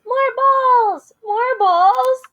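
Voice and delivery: high, loud, repeated cheer; hammed-up excited chant. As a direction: shout it out like a great idea. Characters cheer the idea.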